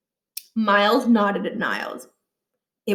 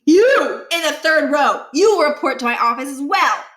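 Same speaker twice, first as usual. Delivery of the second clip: stern command; 5 semitones higher, 5 dB louder than usual. A narrator's reading. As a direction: call him out coldly. Principal catches whisperer.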